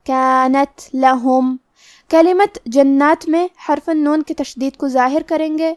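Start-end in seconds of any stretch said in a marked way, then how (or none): 0.1-1.6 کانت لہم very slow, with the ت weakened and softened; tajweed demo. Shown as a demonstration. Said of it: "تو ہم یہ کلمہ کو ایسے پڑھیں گے". (کانت لہم بہت آہستہ، تا کمزور اور نرم آواز میں)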